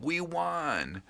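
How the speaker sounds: high, softened mock victory cry; mock fan yell. Sports fans with nachos and pizza celebrating, high muted mock yell. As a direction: imitate a yelling fan without the volume.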